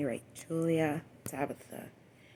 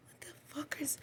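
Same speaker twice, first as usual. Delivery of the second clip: high, breathless unvoiced whisper; stunned whisper; 12 semitones higher, 12 dB quieter than usual. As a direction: gasp it out in shock. Retold stunned reaction.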